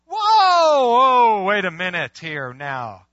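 loud, drawn-out "whoa"; alarmed protest. Acted. Newcomer balking at what the church teaches, alarmed protest. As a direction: halt everything with long, loud whoas.

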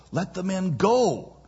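high-pitched plea landing on "go"; "go" emphasized with a strong fall; stress on GO. Shown as a demonstration. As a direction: hit "go" as the key word. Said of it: "the emphasized word being go".

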